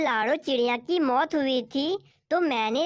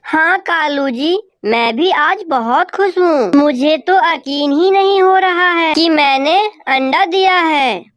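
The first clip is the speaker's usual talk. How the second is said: louder, bright-eyed wife crow in disbelief; Gori crow, happy wife; 3 semitones higher, 12 dB louder than usual. Acted. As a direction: play the thrilled mother crow loud. Narrator voices Gori crow. (खुश कौवी की ऊँची आवाज़)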